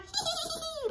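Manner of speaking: high cackling hyena laugh; eerie hyena laugh. Acted. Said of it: "laughing eerily".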